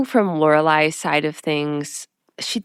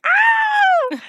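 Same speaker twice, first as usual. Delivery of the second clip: short, very high-pitched shriek; mock scream; 5 dB louder than usual. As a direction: imitate a startled, delighted scream, high and loud. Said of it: "Rory just screams".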